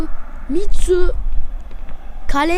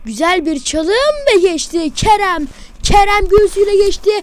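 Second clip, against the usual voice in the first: ordinary delivery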